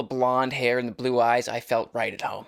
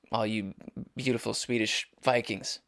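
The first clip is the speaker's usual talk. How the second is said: ordinary talk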